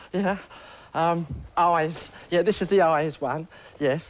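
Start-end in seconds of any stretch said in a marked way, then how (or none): none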